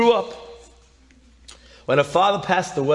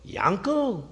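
higher questioning voice of a caller; unknown caller; 4 semitones higher, 4 dB quieter than usual. Retold or imitated. Late-night caller asking for someone by name, higher and questioning. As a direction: imitate a caller unsure who answered. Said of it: "somebody goes".

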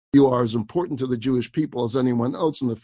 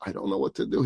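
hushed, softer whisper to himself; worried whisper; 6 semitones lower, 6 dB quieter, faster than usual. Real, not acted. Rabbi at the door, worried, whispering to himself. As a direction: whisper it, at a loss. Worried, husband threatening police.

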